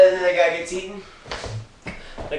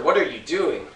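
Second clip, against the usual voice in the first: ordinary talk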